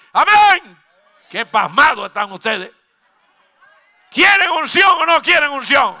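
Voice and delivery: loud, high revival preaching; shouting sermon calling for anointing; fiery preaching. Acted. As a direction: rouse the congregation with each line. Preaching at full force.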